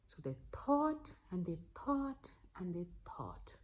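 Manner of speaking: hushed whisper, building suspense. A narrator's reading. Storyteller whispers while family ponders. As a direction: draw listeners in softly.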